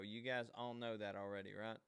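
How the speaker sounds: ordinary delivery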